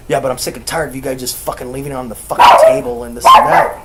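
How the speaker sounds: loud, fast mimicked rant; mocking imitation of an irritated rant; fed-up nephew. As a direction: rattle off a complaint as the angry nephew. Voices nephew's angry complaint.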